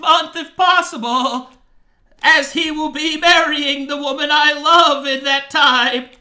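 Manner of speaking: high, loud sly goat man voice; satyr voice. Acted. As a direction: play the scheming satyr. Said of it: "my satyr voice".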